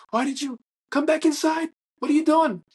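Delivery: very high, exclaimed questioning; Barry. Retold or imitated. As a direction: call out in a high, put-on voice. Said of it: "he's like".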